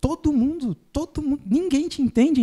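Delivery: TODO MUNDO pitched high, repeated in disbelief; high-pitched doubting questions on TODO MUNDO; incredulous questioning intonation. Shown as a demonstration. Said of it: "colocar uma entonação na voz".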